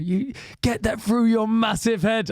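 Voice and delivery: high, drawn-out imitation of a shout; mock shout. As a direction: imitate a wrestler yelling an insult, stretched out. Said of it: "shout that at him".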